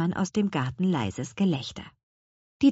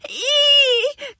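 piercing high squeal; loud, drawn-out "eek" shriek; 6 dB louder than usual. A narrator's reading. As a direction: squeal in panicked disgust. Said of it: "kreischte sie".

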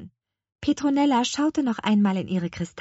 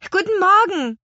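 much higher, loud call upward; children calling up; 12 semitones higher, 8 dB louder than usual. Acted. Twins calling up to old woman on balcony, loud and high. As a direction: shout a greeting up to a balcony. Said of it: "riefen sie".

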